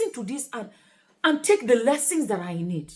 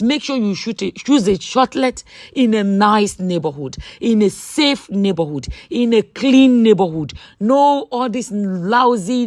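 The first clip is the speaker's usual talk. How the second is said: louder, emphatic sales pitch; promo ad read; 9 dB louder than usual. Real, not acted. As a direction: sell it with punchy stressed key words. Promotes her own shortlet.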